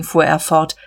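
usual speaking voice

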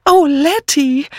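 high, drawn-out excited call; excited cry. A narrator's reading. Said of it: "rief nur Miss Banner aufgeregt". (aufgeregter, hoher Ausruf)

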